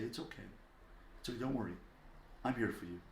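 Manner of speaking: gentle, hushed soothing; tender parent calming a frightened child; comforting. Acted. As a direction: softly reassure a crying child. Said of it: "And we comfort the child".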